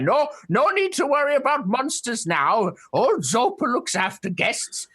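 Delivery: put-on high, curious old turtle creature voice; a wary hermit peering at strangers; old turtle man. GM voicing the old turtle hermit to the party.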